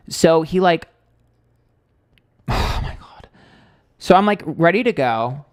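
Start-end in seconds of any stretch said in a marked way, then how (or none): 1.7-3.3 sighed out, voiceless and slow; exasperated sigh. Real, not acted. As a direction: let it out on a weary breath. Exasperated aside mid-story.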